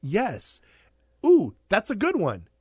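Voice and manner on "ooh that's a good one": excited. Real, not acted. Excited idea, playful argument.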